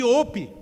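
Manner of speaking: OPE said as "Ô-pi", with no H; Brazilian-style OPE, starting on the vowel; dropped H. Retold or imitated. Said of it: "que não sabem o inglês chamavam ela".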